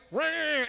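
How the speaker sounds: loud, high mock bleating roar; mock sheep roar. Preacher mocking foolish sheep jumping off cliff, loud roar. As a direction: bellow it like a silly sheep. Mimicking dumb sheep charging.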